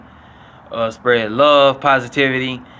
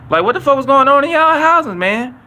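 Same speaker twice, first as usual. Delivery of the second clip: pitched way up in disbelief; incredulous; 11 semitones higher, 4 dB louder than usual. Real, not acted. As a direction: ask it like you can't believe it. Incredulous rant at audience.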